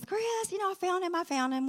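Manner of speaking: high call across to Chris. Retold or imitated. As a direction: call out to him with relief. Calling out to her husband.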